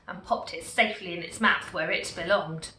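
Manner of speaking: ordinary delivery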